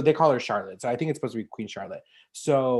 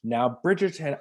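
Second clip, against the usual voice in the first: ordinary delivery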